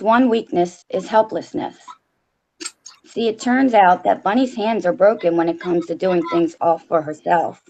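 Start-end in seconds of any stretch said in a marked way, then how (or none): none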